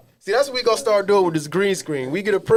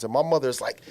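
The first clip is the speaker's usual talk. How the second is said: usual speaking voice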